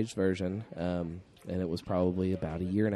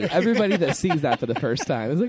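higher pitched laughing, louder; 10 semitones higher, 4 dB louder than usual. Real, not acted. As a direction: laugh through the words. Laughing about the hats.

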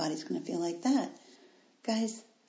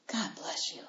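whispered blessing; 9 dB quieter than usual. Real, not acted. Moved by listeners' handwritten cards.

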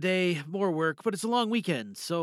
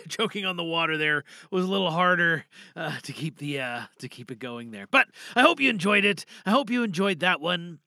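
usual speaking voice

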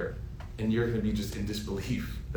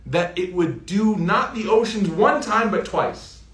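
rising preaching; 6 semitones higher, slower than usual. Acted. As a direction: build the question with fire. Preacher builds to a peak.

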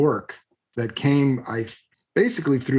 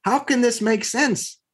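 higher-pitched retelling of inner panic and outrage; mock exasperated rant, pitched up; retold exasperation; 9 semitones higher than usual. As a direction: voice the thought of losing both deal and house. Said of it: "And I'm like".

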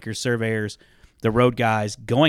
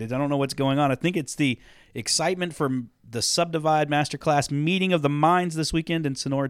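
ordinary talk

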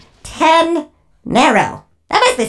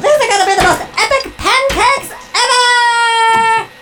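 excited shout; 7 semitones higher than usual. Acted. Excited robot cheers.

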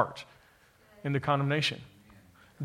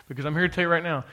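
normal talking voice

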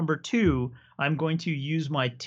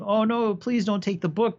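a light, higher plea from the monks; pleading monks; 8 semitones higher than usual. Retold or imitated. Monks begging the rake to leave the book, mild pleading. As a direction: beg him not to take it, lightly voiced.

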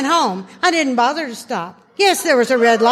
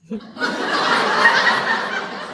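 squeaky airless giggle; high wheezing giggle; high breathless giggle; 3 dB quieter than usual. Real, not acted. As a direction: let a high silent laugh escape. Laughing at husband's face.

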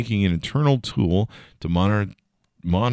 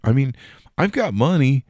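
ordinary delivery

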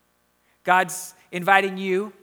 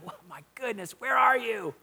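exasperated outburst, pitched up, with a muttered aside; 2 semitones higher than usual. Acted. Preacher imagining God searching for Adam, exasperated. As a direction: throw up your hands in exasperation. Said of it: "Maybe you hear exasperation from God".

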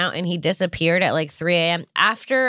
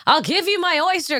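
much higher, eager pleading offer; 10 semitones higher than usual. Retold or imitated. Offering her own oyster card to stressed friend, high and pleading. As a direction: plead brightly to end the search. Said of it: "I was like".

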